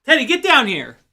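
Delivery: telling Teddy off across the room; scolding the dog. Real, not acted. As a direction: call the dog sharply to come.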